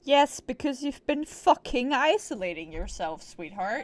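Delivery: loud mock telling-off; half-joking loud rebuke; mock scolding. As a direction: scold her for hiding away.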